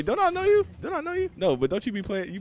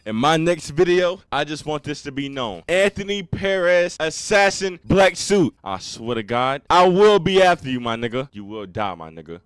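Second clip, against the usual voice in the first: threatening; 2 semitones higher, 9 dB louder than usual. Real, not acted. Threatening an assassin.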